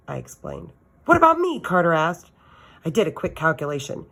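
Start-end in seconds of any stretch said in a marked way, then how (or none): 1.0-1.6 put-on high, loud child voice; eager boy. A narrator's reading. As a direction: chirp it out like a kid.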